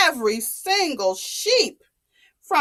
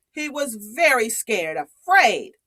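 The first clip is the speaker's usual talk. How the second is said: usual speaking voice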